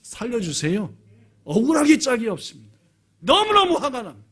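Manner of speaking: acted cry building to loud, high anger; angry lament. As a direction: start pleading, build to an outburst. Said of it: "탄식이었습니다". (점점 높고 크게 터지는 분노의 탄식)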